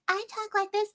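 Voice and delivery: high, lightly put-on lady voice. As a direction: squeak it out like a woman character. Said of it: "You have, like, one lady voice".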